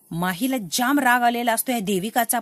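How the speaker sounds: ordinary delivery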